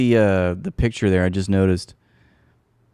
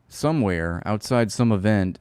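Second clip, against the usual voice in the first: ordinary delivery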